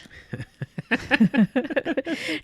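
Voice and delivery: bursting into laughter; a real laugh at own joke; cracking up. Real, not acted. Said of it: "I'm laughing at my own titles".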